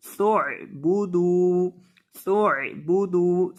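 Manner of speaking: सु'बुदू with सीन run straight into the आइन; joined sin and ain. Shown as a demonstration. Teacher joining sin to ain, slow careful recitation. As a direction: say सु'बुदू slowly, no gap before the ain. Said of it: "सीन को आइन के साथ मिलाएंगे".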